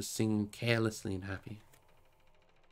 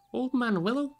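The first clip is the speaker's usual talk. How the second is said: surprised; 10 semitones higher than usual. A narrator's reading. Tom reacts surprised.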